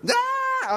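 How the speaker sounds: high sharp mock scream of the crowd; a sudden shriek like an angry crowd; mock crowd yell. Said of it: "people are screaming and hollering".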